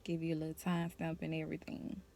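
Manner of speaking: ordinary talk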